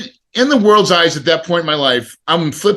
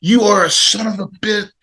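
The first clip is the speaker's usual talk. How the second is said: cursing himself; 6 semitones higher than usual. Retold or imitated. Dad in his car after missing the game, angry self-curse. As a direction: snap at yourself in disgust, a bit higher. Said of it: "I unleash on myself".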